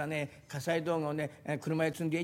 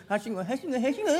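bouncing vendor call rising higher and louder; springy ladder cry; 7 semitones higher, 4 dB louder than usual. Retold or imitated. Ladder seller hawking ladders, bouncy rising cry. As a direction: kick each word up like climbing rungs. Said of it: "ポンポンと蹴上がるような調子で".

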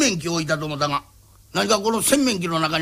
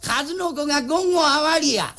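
proud call out; 11 semitones higher, slower than usual. Acted. Proudly announcing the haul.

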